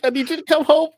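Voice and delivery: retold through laughter. Laughing while retelling the phone call.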